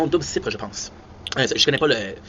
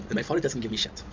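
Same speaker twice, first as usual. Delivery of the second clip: ordinary delivery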